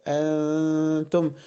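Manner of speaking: أنتم with a long hummed n; tongue suspended mid-mouth, humming before the ت of أنتم; ikhfa. Shown as a demonstration. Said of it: "في النص كده". (أنتم بغنّة الإخفاء)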